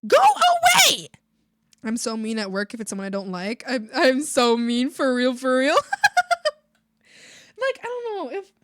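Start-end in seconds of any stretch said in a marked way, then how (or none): 0.1-1.1 high, loud, slow yell; drawn-out shouted dismissal. Real, not acted. 5.8-6.5 loud, high-pitched laugh. Real, not acted. Laughs at own remark.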